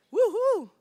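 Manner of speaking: loud, high whoop; excited cheer. Real, not acted. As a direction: cheer with relief. Presentation finally working.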